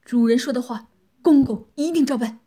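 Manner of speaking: loud, urgent submissive promise; hurried compliance. A narrator's reading. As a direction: rush to assure the mistress. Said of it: "急忙说道".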